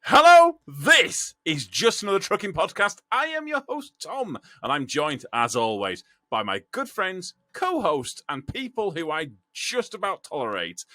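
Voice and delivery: showy, swooping announcer delivery; podcast host intro. Acted. Host introducing co-hosts to listeners, theatrical presenter manner.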